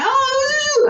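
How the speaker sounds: put-on high-pitched doggy baby talk. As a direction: coo nonsense at a dog, way up high. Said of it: "you speak to the dog".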